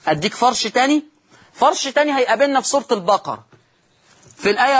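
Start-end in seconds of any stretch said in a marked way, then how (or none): none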